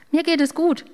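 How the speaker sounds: high, loud cheerful call. A narrator's reading. The yeast calls to the baker, high and loud. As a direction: sing it out brightly from the shelf. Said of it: "ruft da die Hefe".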